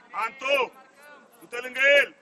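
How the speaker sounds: very loud touchline order; coach's shout. Real, not acted. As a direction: shout hard to send a player over. (strigăt puternic de antrenor)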